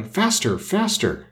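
high urging cry without extra volume; urgent crying out. A narrator's reading. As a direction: urge a runner on, pitch climbing. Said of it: "the queen kept crying".

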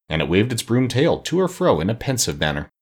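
ordinary delivery, nothing marked

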